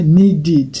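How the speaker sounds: needed in two slow syllables, nee-did. Shown as a demonstration. Teacher showing D plus ED, needed said slowly as nee-did. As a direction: say needed with a full id ending. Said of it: "E D doit se lire id".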